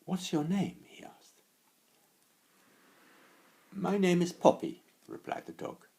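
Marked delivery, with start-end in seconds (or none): 0.0-0.8 put-on higher, curious boy voice; lightly voiced curious child; Silly Billy voice. Billy asks the dog. 3.7-4.8 higher, louder friendly dog; bright eager dog voice. Acted. Dog introduces herself.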